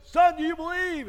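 high, loud demand; mock insistent pastor. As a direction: shout it as an exasperated baptizing preacher. Pastor demands again at baptism.

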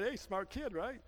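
normal talking voice

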